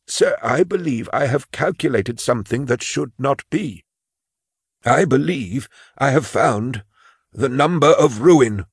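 put-on high, timid old clerk voice; a frail old clerk confessing his fear; old man Imus.

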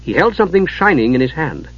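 ordinary delivery

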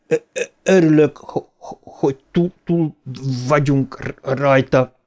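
broken, stammered relief; stuttering. Acted. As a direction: get stuck on the first sound of word after word. Stuttering character speaks.

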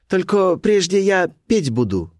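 put-on high, slow girlish character; Colibri's voice. A narrator's reading. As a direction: state it high and drawn out. Colibri sets her condition.

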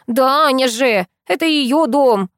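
plain reading voice

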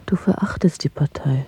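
softly whispered accusation; hushed lover's whisper. Acted. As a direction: whisper it so no one can overhear. Secret lovers talking in hiding.

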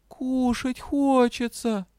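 high, drawn-out kitten whine; plaintive whine. A narrator's reading. Hungry kitten begging the dragon, plaintive. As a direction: stretch it out pitifully as a kitten. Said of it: "жалобно так протянула".

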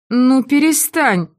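пьяно, высоко, медленно; как захмелевшая, путаясь в словах; заплетающимся языком. A narrator's reading. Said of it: "заплетающимся языком проговорила Мари". (пьяная Мари просит Симона перестать, заплетаясь)

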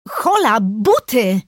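high, sudden objection; sharp protest, jumping up in pitch. A narrator's reading. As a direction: call a halt in alarm. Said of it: "zaprotestowałam".

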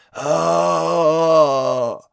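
long rasping groan of a dying man; drawn-out hoarse cry. A narrator's reading. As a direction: drag out a hoarse wordless cry. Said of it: "тягуче хриплое".

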